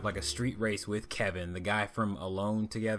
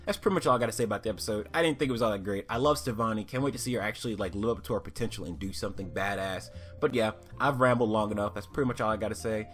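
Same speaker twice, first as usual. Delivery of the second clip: ordinary delivery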